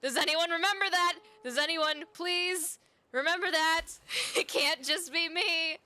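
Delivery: high, loud, laughing desperation; worked-up pleading. Real, not acted. Streamer begging viewers to share an obscure memory, frantic and amused.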